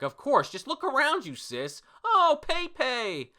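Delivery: high, loud manga sibling voice; hammed-up anime bickering. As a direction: squabble in a put-on high voice. Acting Page One and Ulti bickering.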